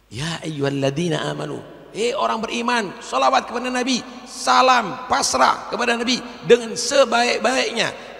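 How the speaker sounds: preacher shouting an appeal to the faithful; full-force preaching. Acted. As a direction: rouse the believers with a ringing cry. Calling out to believers.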